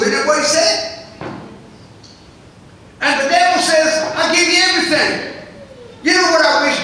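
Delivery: loud, high preacher's cry; full-force preaching. Acted. Preacher crying out to the church about the devil. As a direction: preach it hard to the congregation.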